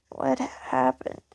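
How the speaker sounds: slow hoarse unvoiced croak; croaking to Jimmy after waking hurt. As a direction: rasp it out weakly. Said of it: "I croak".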